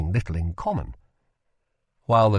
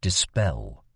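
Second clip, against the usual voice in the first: low command; 9 semitones lower than usual. Acted. Kel finishes the spell.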